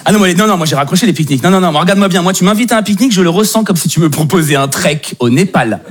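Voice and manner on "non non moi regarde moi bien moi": ordinary delivery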